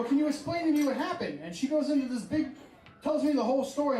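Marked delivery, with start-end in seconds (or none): 0.0-1.4 lightly disguised tech support caller, pitched up. Retold or imitated. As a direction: pose as an agent in a higher voice. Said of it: "I disguise my voice".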